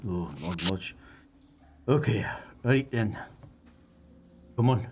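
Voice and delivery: muttering, halting Scottish dwarf; Scottish dwarf resigned to moving on; Scottish accent. Acted. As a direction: think aloud in a Scottish brogue. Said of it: "the Scottish in me".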